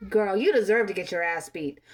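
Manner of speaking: normal talking voice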